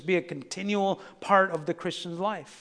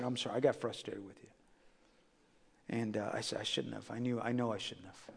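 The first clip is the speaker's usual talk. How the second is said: quiet; 7 semitones lower, 10 dB quieter than usual. Retold or imitated. Apologizing to his boys.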